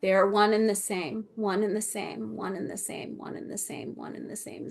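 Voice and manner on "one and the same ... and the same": repeated, softer each time until unvoiced; fading to a whisper. Shown as a demonstration. Relaying the spirits' message, fading echo to a whisper. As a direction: let it echo away into a whisper. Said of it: "They're really emphasizing".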